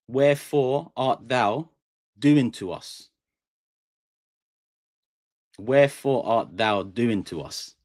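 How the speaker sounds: slow, drawn-out "Wherefore art thou" line; slow dramatic demo. Shown as a demonstration. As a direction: stretch each word like reciting a play. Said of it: "In a sentence".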